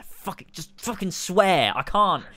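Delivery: loud, slow exasperated yelling; angry rant. Real, not acted. Ranting to a friend about brand memes, loud and furious. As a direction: blow up at how cringy it is.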